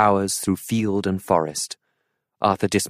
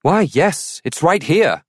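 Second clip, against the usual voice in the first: higher, louder call back over the shoulder; 9 semitones higher, 5 dB louder, slower than usual. A narrator's reading. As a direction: answer a distant voice. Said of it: "Arthur called back".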